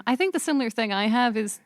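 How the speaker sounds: ordinary delivery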